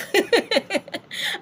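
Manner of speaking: high giggling burst; laughing. Real, not acted. Teacher tickled by own urging to go check, laughing. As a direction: break into high, quick laughter. Amused after telling them to check.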